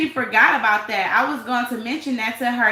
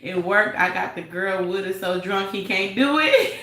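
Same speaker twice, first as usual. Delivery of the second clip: normal talking voice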